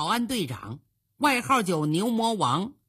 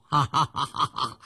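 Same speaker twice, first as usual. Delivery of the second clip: low-pitched burst of "ha ha ha"; deep storyteller's belly laugh; hearty acted laugh; 8 semitones lower than usual. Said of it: "突然一阵大笑".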